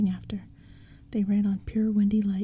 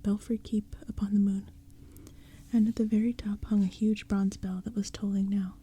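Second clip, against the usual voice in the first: usual speaking voice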